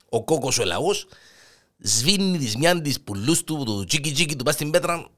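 normal talking voice

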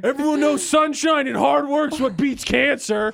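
mock-earnest voice of a smug official; sarcastic. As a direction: say it dripping with sarcasm.

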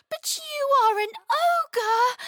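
very high, slow, drawn-out wail; high-pitched frightened child; horrified wail. A narrator's reading. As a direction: wail it in terror. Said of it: "she wailed".

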